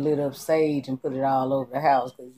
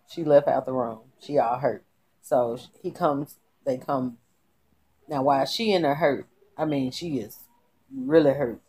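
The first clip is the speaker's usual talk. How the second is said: usual speaking voice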